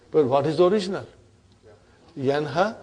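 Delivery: ordinary delivery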